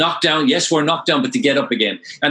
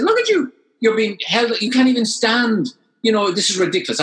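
high-pitched mock scolding of a worn-out husband; wife scolding; 8 semitones higher than usual. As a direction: imitate the wife telling him off. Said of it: "she was giving it to me".

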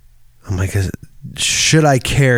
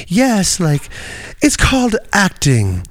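much higher, tongue-in-cheek theatrical voice; playful director; 7 semitones higher than usual. Retold or imitated. The Cuphead director mocking acting, high and playful. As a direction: deliver it like a hammy theatre director. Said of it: "the director of the play is like".

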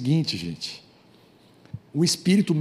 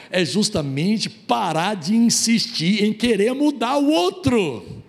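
slow, rising to a high cry on o outro; preacher's slow rising emphasis; emphatic sermon peak; 7 semitones higher, slower than usual. Real, not acted. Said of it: "sermon climax on key phrase".

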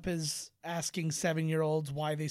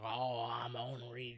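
softer mock Cajun mumble; Cajun accent; 5 semitones lower, 6 dB quieter, much slower than usual. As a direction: imitate a Cajun, low-key. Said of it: "a little bit of a Cajun accent".

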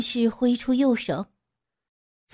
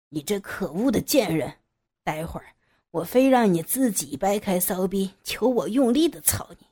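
menacing villain; 2 semitones lower than usual. A narrator's reading. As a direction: growl it out with menace. Villain voiced in story.

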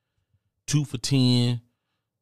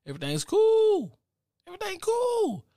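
giddy. Acted. Happy after a good time.

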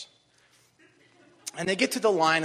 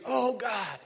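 David's prayer; 4 semitones higher, 5 dB louder than usual. Retold or imitated. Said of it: "and David prays".

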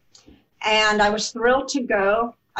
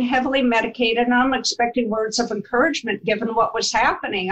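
usual speaking voice

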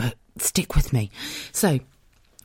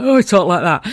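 put-on a light northern English accent, a bit louder; northern husband impression; 4 semitones higher, 7 dB louder than usual. Wife imitating her northern builder husband, light northern accent. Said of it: "I do an impression of my husband".

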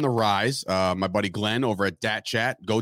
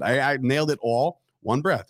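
ordinary talk

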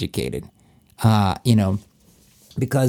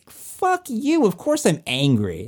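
mock outburst; 9 semitones higher, slower than usual. Victim firing back at an onlooker, mock outrage. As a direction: act out an indignant comeback, pitched way up.

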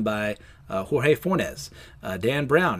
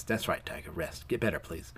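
low, soft words to someone asleep; tender hush; 7 semitones lower, 10 dB quieter than usual. Retold or imitated. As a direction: soothe a sleeper, acted softly and low. MJ soothes the sleeping Peter.

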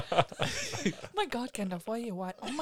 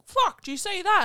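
imitating Dad; 12 semitones higher, 7 dB louder than usual. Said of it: "Dad being there and going".

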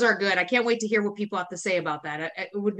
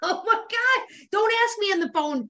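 exasperated; 8 semitones higher than usual. Acted. Venting about being asked again.

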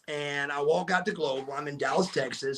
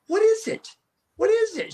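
anxious pleading; 3 dB louder than usual. Retold or imitated. Pressing silent wife in car.

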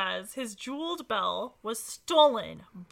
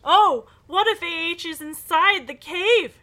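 loud worried cry; excited exclamation; 6 semitones higher, 8 dB louder than usual. Acted. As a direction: exclaim with sudden worry. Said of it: "Nancy exclaims".

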